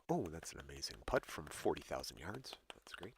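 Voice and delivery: hushed, low golf commentary; golf announcer. Retold or imitated. Joking about how golf announcers restrain themselves, hushed. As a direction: whisper the praise like a restrained commentator. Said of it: "I do like golf announcers".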